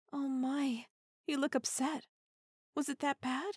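normal talking voice

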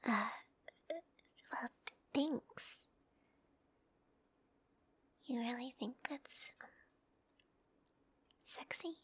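embarrassed by his compliment; shy whisper. Acted. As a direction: hush it out, flustered and shy.

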